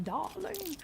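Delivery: put-on soft, lilting darling in a borrowed accent; own Australian darling. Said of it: "That's me, I'm like".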